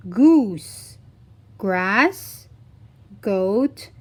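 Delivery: ordinary talk, nothing marked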